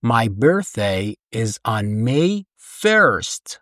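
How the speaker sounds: very slow careful sentence; teacher modelling very slowly; slow date model. Shown as a demonstration. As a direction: draw out every word for learners. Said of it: "مثلاً".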